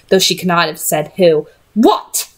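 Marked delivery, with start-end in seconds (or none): 1.7-2.3 a short, high, hostile what; a lightly voiced angry young man; growled. A narrator's reading. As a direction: bite off the question in anger. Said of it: "Gabriel growled".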